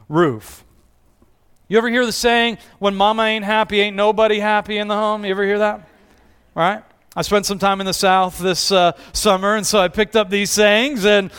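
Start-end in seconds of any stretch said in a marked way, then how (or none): none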